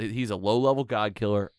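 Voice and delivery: ordinary delivery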